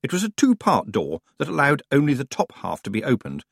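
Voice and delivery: usual speaking voice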